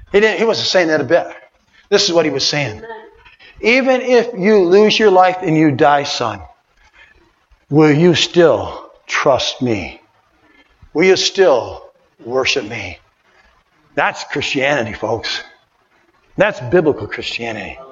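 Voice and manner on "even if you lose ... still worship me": much lower, slow, searching question; low, slow voice of God; slow, solemn voice of God. Acted. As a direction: ask it slowly, deep and hushed. God asking, slow and hushed.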